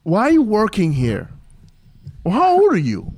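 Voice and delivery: high-pitched, rude interrogation; obnoxious asshole. Retold or imitated. Young waiter challenging the older new hire, obnoxious asshole. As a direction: needle him like a total asshole. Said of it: "Just like that. The total fucking asshole I was".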